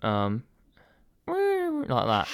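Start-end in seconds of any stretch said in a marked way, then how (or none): 1.2-2.3 high squeaky dolphin whistle; high-pitched dolphin squeal with a laugh; dolphin impression. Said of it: "do a dolphin".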